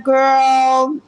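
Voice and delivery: put-on high, drawn-out girl; Mama Love's girl. Mother to daughter Jax, high drawn-out girl. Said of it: "She was like".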